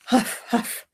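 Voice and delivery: breathy, voiceless huffing; panting huffs. Acted. Rider climbing Chicken Hill, huffing for breath. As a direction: puff out each huff like a tired rider.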